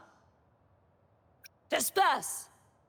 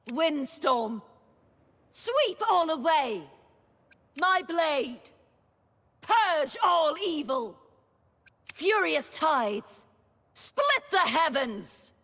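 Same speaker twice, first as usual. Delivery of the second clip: raised, commanding incantation; battle cry; 6 semitones higher than usual. Acted. Knight commands blade and tides to purge evil, battle cry. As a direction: summon the storm with a high, forceful call. Knight unleashing attacks in combat.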